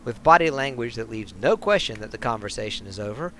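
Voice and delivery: lively storybook read of the bar scene; story narration. A narrator's reading. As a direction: narrate it like a sly novel. Reads game story text.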